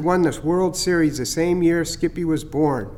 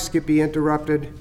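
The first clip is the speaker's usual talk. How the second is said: ordinary delivery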